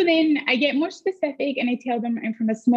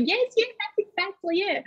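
eager agreement; 9 semitones higher, 4 dB quieter than usual. Retold or imitated. Nodding listeners voiced.

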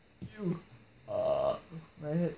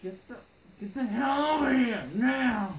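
angry yell; 8 semitones higher, 7 dB louder than usual. Acted. Boss yelling at character.

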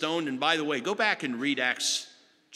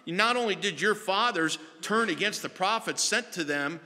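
rising, higher preaching that swells to the end; fiery rebuke; 6 semitones higher than usual. Acted. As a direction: preach it like Stephen accusing his judges. Stephen's rebuke of the council.

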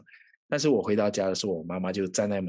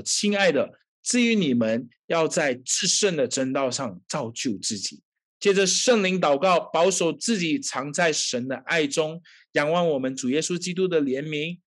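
fervent preaching; 5 semitones higher than usual. Acted. Pastor exhorting the congregation, fervent preaching. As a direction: drive the point home to the congregation.

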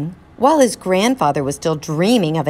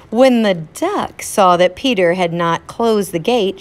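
ordinary delivery, nothing marked

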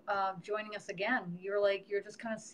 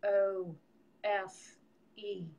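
letters slow, one by one; spelling it out; much slower than usual. Shown as a demonstration. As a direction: spell the name slowly like the angels dictate. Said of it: "They'll go".

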